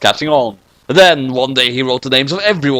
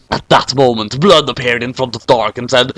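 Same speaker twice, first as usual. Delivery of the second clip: plain reading voice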